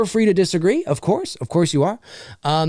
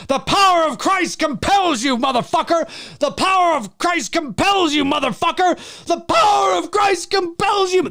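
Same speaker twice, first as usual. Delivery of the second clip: higher, building to a shout; hammed-up exorcist; 10 semitones higher than usual. Imagining a profane exorcist, building shouted incantation. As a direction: bellow the exorcism over and over.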